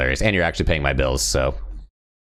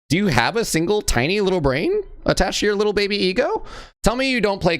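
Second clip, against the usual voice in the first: mocking taunt; 13 semitones higher than usual. Taunting the salty player.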